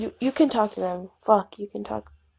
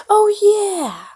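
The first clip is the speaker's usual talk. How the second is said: much higher, loud "Oh yeah!"; excited squeal; 13 semitones higher, 7 dB louder than usual. Acted. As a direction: burst out with glee. Sudden high excited cry.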